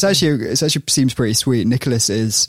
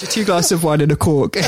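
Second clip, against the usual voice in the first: laughing, higher Geordie voice; Geordie accent; 5 semitones higher than usual. Retold or imitated. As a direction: imitate the Geordie customer. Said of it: "Geordie accent".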